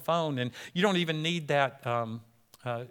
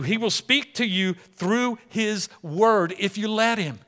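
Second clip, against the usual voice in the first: usual speaking voice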